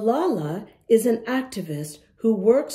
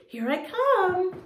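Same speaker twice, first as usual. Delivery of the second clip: sing-song high teasing "here I come"; playful high call; 11 semitones higher than usual. Pretend. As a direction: tease the kids as you come closer, voice up high. Playful approach to the camera.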